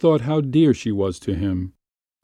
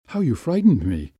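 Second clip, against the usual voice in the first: startled, higher voice with falling end; understated shock; mildly breathless fright; 4 semitones higher than usual. A narrator's reading. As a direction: catch your breath after a scare. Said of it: "breathing heavily".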